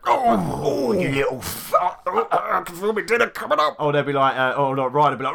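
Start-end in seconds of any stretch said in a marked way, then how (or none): 0.1-3.8 high, drawn-out winded groan; gut-punched groan. Acted. Player reacting to a punch in the stomach, hammed-up winded groan. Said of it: "If somebody hits you in, in the stomach".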